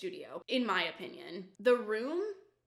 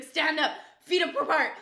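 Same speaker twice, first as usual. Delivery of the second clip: high, loud barking commands; drill sergeant orders; 6 semitones higher, 8 dB louder than usual. Retold or imitated. Imitating the instructor yelling at the class, loud. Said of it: "she was like a drill sergeant".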